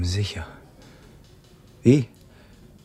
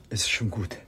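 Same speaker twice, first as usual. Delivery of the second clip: faint whisper; 4 semitones lower, 10 dB quieter than usual. Acted. Faint man thanks helper.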